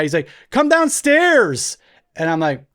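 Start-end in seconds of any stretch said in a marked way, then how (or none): 0.5-1.8 put-on high, slow drawn-out kid's call. Older boy calling his babysitter downstairs, calling out. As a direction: summon someone from another floor.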